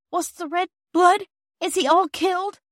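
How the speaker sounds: put-on high, loud frightened child; a scared girl asking if he's dead; fearful question. A narrator's reading. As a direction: ask in dread. Phyllis fearful about injured man.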